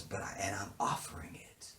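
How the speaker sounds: whispered retold line; hushed quote from the show. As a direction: say it under your breath.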